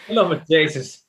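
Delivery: put-on Irish accent. Co-host parroting the Irish chaplain, hammed-up Irish accent. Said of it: "speaking in an Irish accent".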